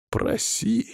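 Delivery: high, soft, scornful echo; contemptuous snort. A narrator's reading. Hero echoing Bolo's demand to ask, contemptuous. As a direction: throw the word back with disdain. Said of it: "презрительно фыркнул я".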